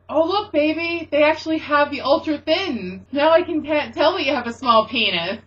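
loud fake-sweet taunt in the condom aisle; a friend mocked loudly in the condom aisle; mock teasing. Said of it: "I said".

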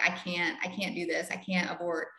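low, soft retold helpless refusal; quietly quoted plea. Girlfriend quoted refusing abortion.

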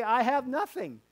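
"I have nothing" high and loud; plain English demo. Shown as a demonstration. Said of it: "We say".

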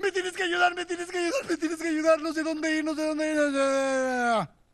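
hammed-up whine trailing into a long moan; wailing plea. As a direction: beg for help, stretching the last words. Gomer ruega ayuda a Oseas. (ruego lastimero y teatral)

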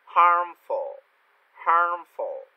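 loud, high "Harmful" with tongue curled after the a. Shown as a demonstration. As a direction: punch out "harmful", curling into the r. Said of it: "cố gắng cong lưỡi cho thầy". (đọc to "harmful", cong lưỡi ở âm ar)

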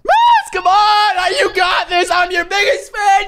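high, loud superfan shouting; over-the-top cheering. Pretend. Cheering on his opponent.

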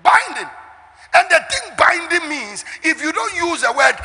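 high, loud, forceful sermon delivery; preaching at full force. Real, not acted. Preacher teaching the congregation out of ignorance, preaching at full force. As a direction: hammer it out to the congregation.

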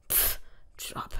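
whispered, scornful mumble under the breath; mumbled. A narrator's reading. Father mutters scornfully about the boyfriend's job, whispered. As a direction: mutter it dismissively, barely voiced. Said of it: "nuschelte mein Vater".

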